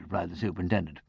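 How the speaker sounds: ordinary delivery, nothing marked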